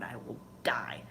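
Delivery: hushed mock-dramatic whisper; whispered despair. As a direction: whisper it like it's the end of the world. Retold panic over stuck window.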